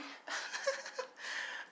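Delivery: very high, soft giggle; squeaky Joker-like cackle; crazed villain cackle. Acted. Gremlin mocking Deku, crazed high cackle.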